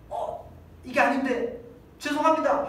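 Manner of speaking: high, startled then pleading; mock regret in hell. Soul in hell suddenly realising its mistake, high and pleading. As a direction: realise too late and apologise. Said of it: "이렇게 질문할 때".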